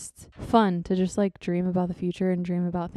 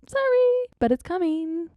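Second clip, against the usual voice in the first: high-pitched, louder mock-sheepish sorry; playful apology; 11 semitones higher, 6 dB louder than usual. Playful apology for a late gift.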